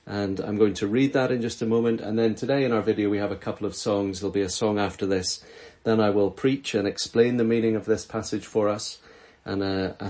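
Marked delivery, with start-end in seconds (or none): none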